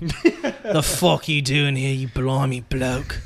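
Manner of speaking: slow, drawn-out street British caricature; mock a rough street British accent; street British impression. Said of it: "You're, like, straight street British".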